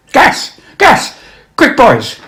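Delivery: high, loud cry of warning; frantic shouted warning to the men; alarm shout. Acted. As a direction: yell it as the gas hits. Soldier's alarm in the poem.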